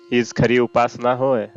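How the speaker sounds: usual speaking voice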